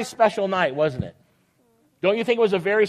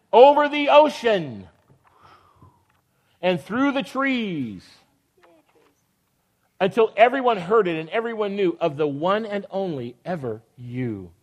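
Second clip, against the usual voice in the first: storybook reading; slower than usual. Acted. Reads picture book aloud.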